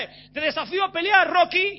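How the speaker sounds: provocador chillón, más agudo y fuerte; desafía al campeón a gritos; retador burlón. Retold or imitated. (retador provocador, agudo y fuerte)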